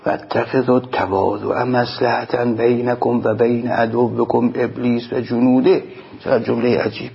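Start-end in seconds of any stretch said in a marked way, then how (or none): 0.1-5.8 measured, slow chanting of the Arabic text; solemn recitation of a sermon quote. Acted. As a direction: intone each Arabic word slowly like scripture.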